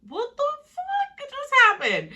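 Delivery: high, slow, stunned disbelief; a stunned contestant's blank high voice; shocked Hannah. Retold or imitated. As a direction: play her totally thrown, drawn out up high. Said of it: "Hannah is like".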